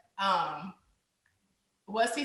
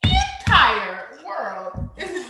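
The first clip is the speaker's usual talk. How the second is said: high, slow, loud laughing disbelief; teacher gaping at chaos, laughing; stunned disbelief; 4 semitones higher, 10 dB louder, slower than usual. Retold or imitated. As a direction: stretch it out in shocked amusement. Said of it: "I'm like".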